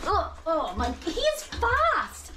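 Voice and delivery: high, drawn-out amazement at the dog; amazed. Real, not acted. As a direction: gush at the wild little dog. Dog going wild while drying.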